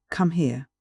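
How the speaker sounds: ordinary delivery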